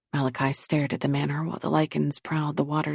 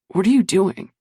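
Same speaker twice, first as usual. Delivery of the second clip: loud, sharp demand; 3 semitones higher, 6 dB louder than usual. A narrator's reading. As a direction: call it out demanding. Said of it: "Brock demanded".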